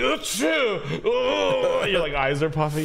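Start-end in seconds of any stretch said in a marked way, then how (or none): 0.0-1.0 loud, high hammed-up sneeze; mock sneeze. Friend acts sneezing on seatmate, loud mock sneeze. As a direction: blast out a fake sneeze. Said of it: "You're like".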